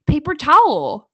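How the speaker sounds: TOWEL said fully as tow-el, loud; retold pronunciation. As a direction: punch "towel" with its full ending. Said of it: "my friend was like".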